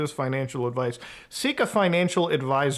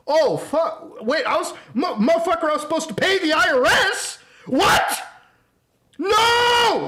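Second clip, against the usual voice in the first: hammed-up freakout, pitch climbing higher and louder to a final shriek; mock panic building to a yell; 11 semitones higher, 4 dB louder than usual. Streamer mocking a YouTuber who forgot the IRS, hammed-up panic rising to a shriek. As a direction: play the clueless rich guy realising he owes taxes, spiralling into panic.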